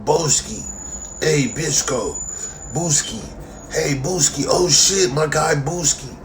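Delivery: imitating fans who misname him; mock fan greeting.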